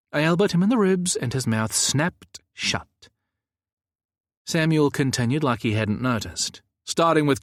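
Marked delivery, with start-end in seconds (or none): none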